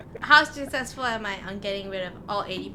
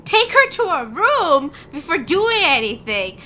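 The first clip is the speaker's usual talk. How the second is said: higher, louder, slow scolding mother; mom lecture; 8 semitones higher, 6 dB louder, slower than usual. Acted. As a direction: scold them like an outraged mom. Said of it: "I'm scolding the mage hands, be like".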